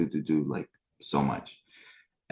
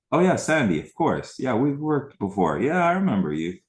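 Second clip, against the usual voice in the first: excited greeting; 9 semitones higher, 6 dB louder than usual. Real, not acted.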